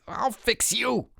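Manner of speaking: slow, high, strained threat; spluttering. A narrator's reading. Harold pulled from the creek, spluttering. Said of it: "he spluttered".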